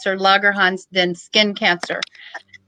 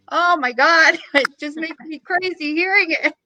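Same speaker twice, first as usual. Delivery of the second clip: laughing; 7 semitones higher than usual. Real, not acted. Exasperated laugh at casket cost.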